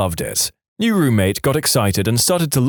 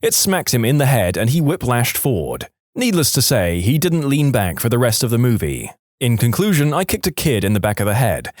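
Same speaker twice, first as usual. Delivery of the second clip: ordinary talk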